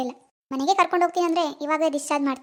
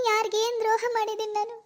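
higher, softer voice, choked with tears; a grieving young woman close to sobbing; tearful daughter; 5 semitones higher, 4 dB quieter than usual. Acted. Daughter grieving her lost baby to her mom, tearful.

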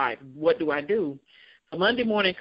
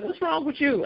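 mom's voice; 9 semitones higher than usual. Retold or imitated. Mom's voice in retold story.

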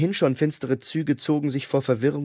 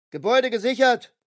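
higher, clipped agent report; a hint of a tactical agent reporting; agent announcing; 10 semitones higher than usual. A narrator's reading. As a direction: call it out like a soldier on the radio. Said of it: "verkündete einer der Agenten".

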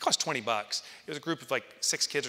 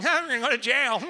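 much higher, louder mocking jab; joking tease; 14 semitones higher, 9 dB louder than usual. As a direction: rib him playfully. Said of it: "he's, like, joking".

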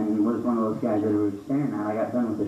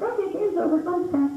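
high, mock playground voice; kid voice. Kids ending the game, high mock playground voice. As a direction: announce it like a bossy kid.

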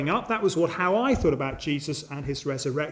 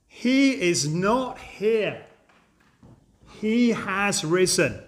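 very slow, weighty declaration; staggering proclamation; much slower than usual. Acted. Preacher unveils the angel's staggering sentence to the congregation, slow proclamation. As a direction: draw out each word, pausing between sentences. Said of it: "then comes the staggering sentence".

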